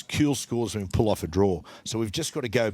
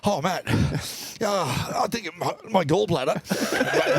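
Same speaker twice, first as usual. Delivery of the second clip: higher, very slow, hammed-up ailing voice; mock sick wife; 6 semitones higher, much slower than usual. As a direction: play the wife faking a gallbladder attack. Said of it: "Trish runs in and goes".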